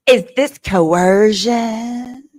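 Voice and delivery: drawn-out, very slow prim question; mocking slow quote. Mocking the testimony, a very slow prissy question. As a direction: stretch each word out in disbelief. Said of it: "Does that even sound like [name]?".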